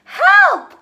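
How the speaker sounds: high, loud cry for help; cried for help. A narrator's reading. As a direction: call out in alarm, high and loud. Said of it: "cried the witch".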